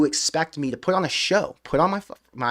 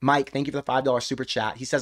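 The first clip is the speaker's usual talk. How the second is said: usual speaking voice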